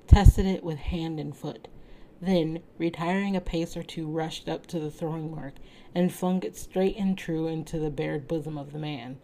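ordinary delivery

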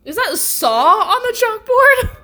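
cracking up mid-question; laughing disbelief. Real, not acted. Viewer spotting Saw on the chalkboard, laughing.

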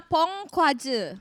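"Pong Kwaje" said slowly, syllable by syllable; slow, careful reading of "Pong Kwaje"; reading out the name. Shown as a demonstration. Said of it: "Ini baca gimana sih?".

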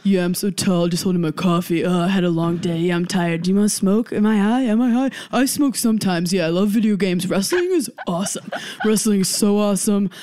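lazy stoner guy, lightly voiced; Dan impression. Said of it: "this is my impression of Dan".

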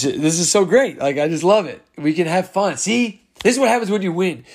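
usual speaking voice

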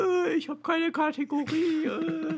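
glitchy high-pitched bad connection; broken robotic call audio; distorted Discord audio. Real, not acted. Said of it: "Alles ist schlimm".

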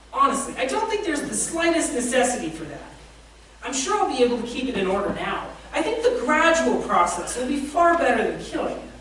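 flustered evasive ghost. A narrator's reading. The ghost stalls the angel, high and nervously evasive. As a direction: squirm out of it, voice climbing high.

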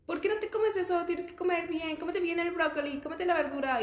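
high, nagging mom voice; Bárbara de Regil mom voice. Retold or imitated. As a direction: scold her like a strict fit mom. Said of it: "imitando a mi mamá un día". (voz aguda de mamá regañona)

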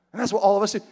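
ordinary delivery

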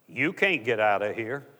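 low, understated voice from the dark. Retold or imitated. Unseen man speaks to the trapped drunk, low mysterious voice. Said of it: "a voice came to him".

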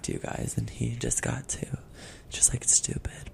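hushed aside; whispering. Real, not acted. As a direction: whisper it secretly. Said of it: "we're gonna whisper this whole time".

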